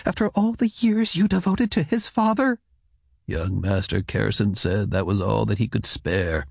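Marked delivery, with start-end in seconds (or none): 0.1-2.6 high-pitched woman's voice, protesting question; indignant woman. A narrator's reading. Wife protests husband's poor reward, high incredulous voice.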